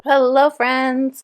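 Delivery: ordinary talk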